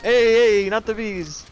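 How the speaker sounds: high hammed-up Italian-tinged cry; mock bees cry. Friend joking the bees line, high mock cry. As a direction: toss off the bees line as a joke.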